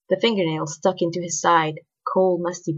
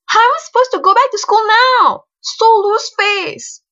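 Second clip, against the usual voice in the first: shrill indignation; 10 dB louder than usual. A narrator's reading. Girl berating her aunts for embarrassing her at school, shrill. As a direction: wail the complaint shrilly. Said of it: "she said shrilly".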